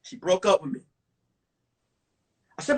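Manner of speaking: ordinary talk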